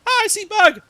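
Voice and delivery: loud, high cartoon bird; hammed-up loud high magpie voice. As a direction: squawk it like a dumb bird. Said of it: "It's like".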